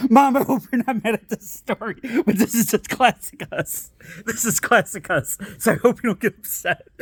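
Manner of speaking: ordinary talk, nothing marked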